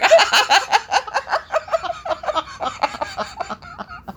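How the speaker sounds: long, high-pitched laughing fit; real helpless high laughter. Real, not acted. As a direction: crack up completely. Laughing at own story.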